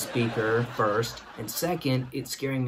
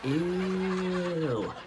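long, drawn-out higher "ew"; stretched, pitched-up mock disgust; disgusted crowd reaction; 5 semitones higher, 3 dB quieter than usual. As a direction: imitate a whole rink groaning in disgust. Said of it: "Everyone in the rink goes".

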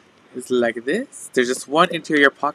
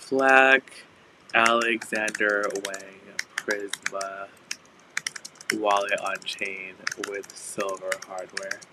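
slow, hushed dramatic announcement; 4 semitones lower, 6 dB quieter, slower than usual. Acted. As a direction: announce it like a grand reveal. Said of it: "Did I sound dramatic when I said that? I was trying to be".

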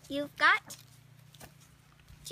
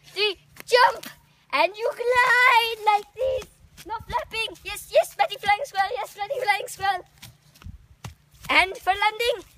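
higher and louder, bursting with excitement; excited loud demo; 6 semitones higher, 10 dB louder than usual. Pretend. Teacher squirrel showing the class how to glide, excited and loud. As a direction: cheer the jump out loud.

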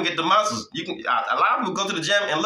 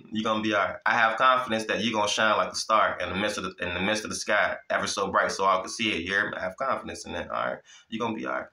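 gentle reassurance; 7 semitones lower than usual. Acted. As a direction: ease them down tenderly. Softly reassures listeners.

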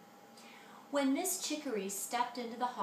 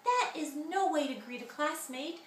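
fussing teacher; 7 semitones higher, 4 dB louder than usual. Acted. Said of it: "flitted and fussed".

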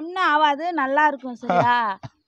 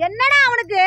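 alarmed shock; 8 semitones higher, 6 dB louder than usual. Acted. Sister hears doctor's news.

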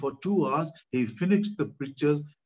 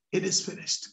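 whispered echo; 4 semitones higher, 3 dB quieter than usual. Retold or imitated. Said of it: "loudly shouting".

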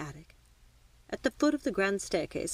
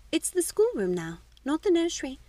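a bit louder, climbing much higher; raised voice; 9 semitones higher, 4 dB louder than usual. A narrator's reading. Daughter correcting mamma after being told to speak up, slightly raised voice. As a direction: raise your voice a little, insistently. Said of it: "raising my voice a bit".

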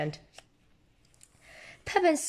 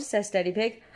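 usual speaking voice

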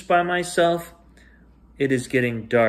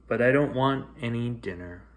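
usual speaking voice

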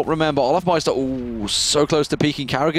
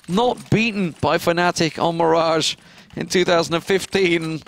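usual speaking voice

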